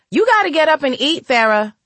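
high, fast, loud order; loud command. Acted. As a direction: bark at her to get up. Mia orders her sister up.

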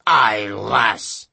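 put-on lightly growled old sea-dog voice; pirate growl. A narrator's reading. Pirate greeting the girl with a light growl. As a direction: grumble like a gruff pirate. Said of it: "the big man growled".

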